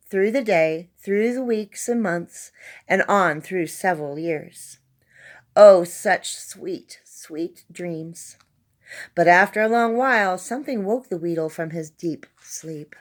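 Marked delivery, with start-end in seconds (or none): 5.5-8.4 slow, savouring, fading to a hush; dreamy. A narrator's reading. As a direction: linger on the sweet dreams, drifting off. Narrator savouring sleep.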